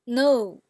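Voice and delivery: loud "No" as a fourth tone; falling tone. Shown as a demonstration. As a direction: drop "No" hard from the top. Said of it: "associated with the fourth tone".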